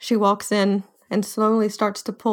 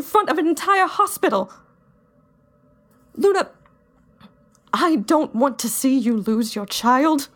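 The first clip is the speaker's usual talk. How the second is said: put-on angry young fighter; 4 semitones higher than usual. [name]'s long angry rant in character.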